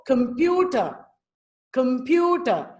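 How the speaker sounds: slow "computer", only "pu" said clearly; deliberate "computer" with the weight on "pu"; clear stressed syllable. Shown as a demonstration. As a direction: reduce the first syllable of "computer", open up "pu". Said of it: "Only the stressed syllable pew is pronounced clearly".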